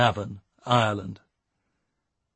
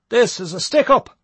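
loud, high and shaky; trembling shout; 11 semitones higher, 8 dB louder than usual. Acted. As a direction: shout it nervously, voice wobbling. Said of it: "shouted Matty, failing to control his trembling voice".

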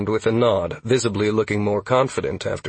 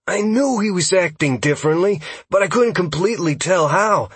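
high, excited elf voice; worked-up, high-pitched realisation; taken aback; 10 semitones higher, 4 dB louder than usual. Acted. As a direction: react to startling news that confirms a hunch. Taken-aback scout reacts to news.

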